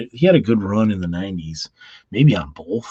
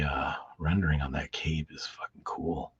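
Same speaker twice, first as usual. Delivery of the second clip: whisper; 4 semitones lower, 8 dB quieter than usual. Real, not acted.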